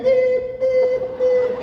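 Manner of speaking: sung high beeps like a phone line going dead; beep imitation. Caller hangs up on him, high sung beep beep beep. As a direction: chirp the hang-up tone way up high.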